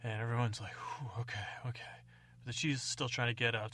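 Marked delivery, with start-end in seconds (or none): 0.7-1.8 breathy sigh of relief, barely voiced; relieved whisper. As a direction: whisper it out, the danger has passed. Relieved onlookers after danger.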